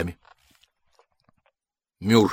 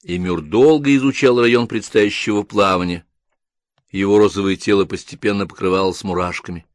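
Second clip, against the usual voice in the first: usual speaking voice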